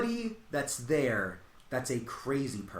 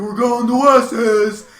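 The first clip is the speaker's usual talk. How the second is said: loud, high, slow goofy announcement; imitating the friend; 9 semitones higher, 16 dB louder, much slower than usual. The uninvited guy in the back seat, imitated goofy announcement. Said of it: "And was like".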